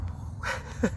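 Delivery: quiet unvoiced laugh; breathy chuckle; soft breathy laugh. Real, not acted. Laughing at himself.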